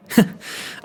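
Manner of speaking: breathy triumphant chuckle; gleeful laugh. Acted. Guessing the bar owner's desk right, a gleeful laugh. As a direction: laugh with delight at being right.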